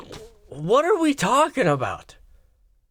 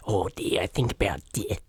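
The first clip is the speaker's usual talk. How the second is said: soft, low Australian goth impression; quiet, gloomy mock Australian voice; Shane Gillis's Australian goth; 11 semitones lower, 6 dB quieter than usual. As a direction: mimic an Australian goth, hushed. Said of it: "Shane Gillis bit".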